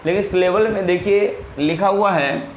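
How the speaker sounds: ordinary talk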